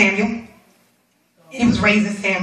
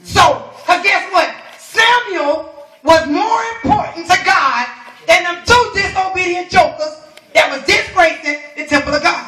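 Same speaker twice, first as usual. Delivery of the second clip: forceful preaching; 6 semitones higher than usual. Real, not acted. Preacher weighing Samuel against two disobedient jokers, full-force preaching. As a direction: hammer each phrase out from the pulpit.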